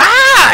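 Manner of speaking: high, loud yelp; startled cry. Acted. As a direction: cry out as you fall backward. Goblin falls off the platform.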